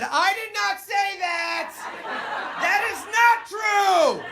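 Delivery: very high, slow, loud shout of denial; mom protesting. Retold or imitated. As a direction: shout from the back like an offended mom. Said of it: "she said".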